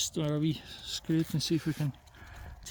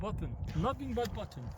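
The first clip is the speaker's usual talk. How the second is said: put-on soft comic Russian accent; mock Russian accent; 6 semitones higher, 8 dB quieter than usual. Mate carries on the button joke, hushed mock Russian accent. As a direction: quietly play the Russian comrade. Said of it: "That's a good accent".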